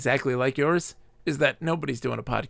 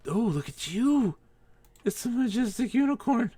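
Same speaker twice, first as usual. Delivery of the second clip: cooing at a unicorn; 10 semitones higher than usual. Pretend. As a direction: coo up high as if to a shy animal. Talking to a unicorn in game.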